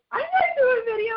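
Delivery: high, mock-crying protest; resisting the leader's push, hammed-up whimper; whiny refusal. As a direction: wail it like a reluctant kid. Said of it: "And I'm like".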